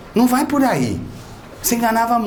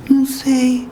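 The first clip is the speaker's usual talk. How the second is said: higher, meek little patient's voice; sheepish patient; 6 semitones higher, slower than usual. Retold or imitated. Patient answering the doctor's question, high and meek. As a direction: shrug it out like a guilty kid.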